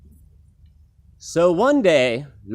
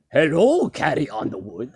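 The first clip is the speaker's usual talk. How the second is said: Dracula voice. Shown as a demonstration. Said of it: "that was the wrong voice".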